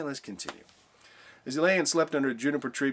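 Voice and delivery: ordinary talk, nothing marked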